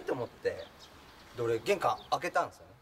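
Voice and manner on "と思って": ordinary talk, nothing marked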